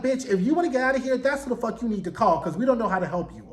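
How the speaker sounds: fast, high, brushing her off; brisk sassy Fates. Retold or imitated. The Fates sending the muse to Morpheus, fast and blunt. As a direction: rattle it off quickly and bluntly. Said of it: "the Fates are like".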